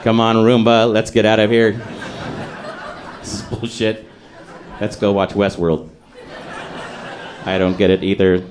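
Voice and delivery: a jaded supervisor letting the robot down; gruff boss. Acted. Gruff boss handing out grades.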